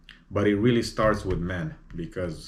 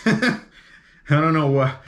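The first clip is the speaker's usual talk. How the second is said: laughing; 7 semitones higher, 6 dB louder than usual. Real, not acted. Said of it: "laughs at own example".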